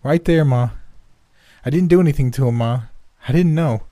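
put-on a scared boy blurting excuses all in one rush; terrified boy pleading. A narrator's reading. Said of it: "speaking the sheer momentum of terror".

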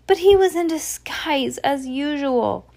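put-on high-pitched little child, slowing at the end; baby Sunny's voice. A narrator's reading. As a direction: pipe it up high like a tiny child. Baby Sunny's cry, translated by narrator.

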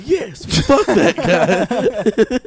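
loud, high, drawn-out cheer; over-the-top celebratory shout; gleeful mock yell. Acted outburst in a joke scenario.

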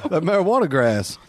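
mock Southern lawman twang; hammed-up Southern sheriff. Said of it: "be like a Southern, like a Georgia sheriff".

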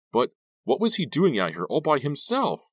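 loud, high-pitched whine; whiny, pestering boy's voice. A narrator's reading. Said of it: "his voice high and whiny".

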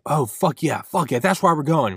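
loud, high burst of hype; excited. Acted. Driver remembering the Boston plans, excited. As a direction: cheer yourself on, pumped up. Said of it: "you start to get excited".

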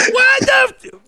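panicked outburst. Retold or imitated. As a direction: caught off guard, yelp it out. Comic panicking at surprise intro.

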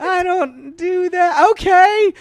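high, stretched protest tipping into a loud yes; hammed-up reluctant squeal. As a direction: squeal a mock protest, then cave loudly. Reluctant then giving in, retold.

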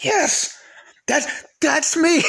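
high, elated cry of recognition; excited recognition. Retold or imitated. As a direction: re-enact an overjoyed reaction, voice shooting up. Acting out delighted reaction to video.

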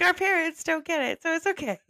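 high-pitched mock quote; imitating other viewers. Viewers excusing clueless parents, high mocking imitation.

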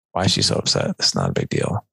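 muttered under the breath, dropping low at the end; dismissive inner thought. Retold or imitated.

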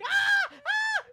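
high mock scream of pain. Mimicking the screaming girl in the waiting room, mock scream. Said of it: "she's just screaming".

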